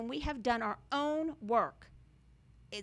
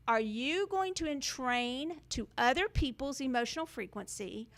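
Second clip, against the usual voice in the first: normal talking voice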